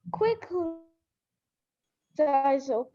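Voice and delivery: usual speaking voice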